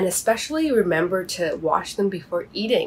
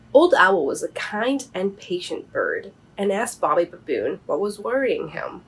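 ordinary delivery, nothing marked

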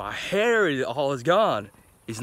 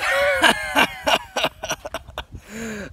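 high burst of laughter; big shrieking laugh; 13 semitones higher than usual. Real, not acted. Laughs at own greeting.

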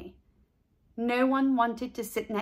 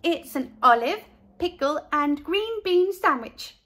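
put-on higher, bright girl voice; a proud little girl showing off her lunch; lightly voiced cheerful girl; 6 semitones higher, 4 dB louder than usual. A narrator's reading. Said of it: "said Carla".

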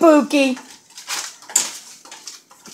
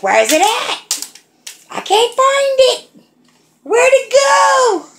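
put-on high baby voice, searching; voicing the baby; 13 semitones higher than usual. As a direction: speak for the baby, high and puzzled. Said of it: "She's like".

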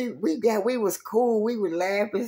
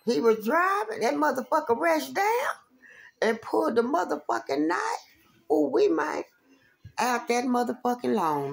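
normal talking voice

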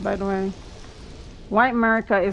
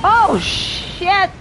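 high, loud shocked exclamation; startled curse; 9 semitones higher, 5 dB louder than usual. Real, not acted. Streamer caught off guard mid-fight, high startled shout. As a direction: blurt it as you nearly die.

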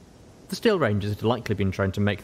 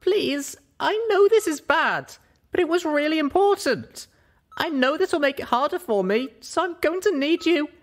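high, louder pleading voice; Little Pip pleading; 3 dB louder than usual. Acted. Little Pip pleads with Velvet, high and earnest. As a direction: beg her to understand.

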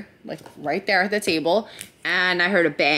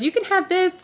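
put-on little girl's voice; 10 semitones higher than usual. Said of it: "the girl says".

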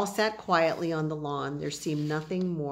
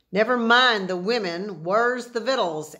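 gruff, louder grumble of a hungry cowboy; grumpy cowboy; 4 semitones higher, 7 dB louder than usual. A narrator's reading. Hungry Boone grumbles at the boys about food. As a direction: growl it out like a cranky old frontiersman. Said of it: "asked every hungry Boone grumpily".

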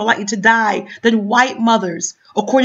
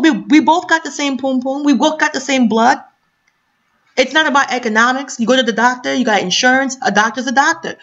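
usual speaking voice

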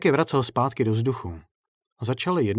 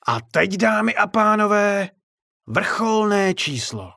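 much higher, louder showman's call, slowing for the finale; circus announcer; 11 semitones higher, 6 dB louder, slower than usual. Acted. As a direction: announce the grand finale to an empty tent. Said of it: "promluvil k neexistujícímu publiku".

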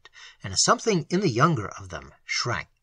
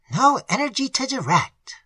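higher, each word pressed hard; emphatic reply; 11 semitones higher, 4 dB louder than usual. A narrator's reading. The old pastor insists to the tutor, emphatically. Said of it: "was the emphatic reply".

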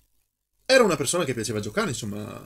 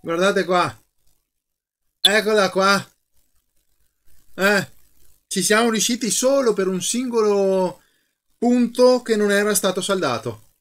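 loud, high thrilled announcement; excited; 6 semitones higher, 4 dB louder than usual. Real, not acted. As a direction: cheer the success to viewers. Repair succeeds, excited cheering. (entusiasta, voce alta e acuta)

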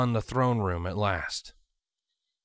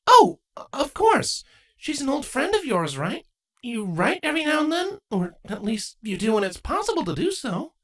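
put-on young mare lifted high and light; Twilight's voice. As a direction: voice Twilight, gently doubtful.

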